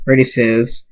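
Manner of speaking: plain reading voice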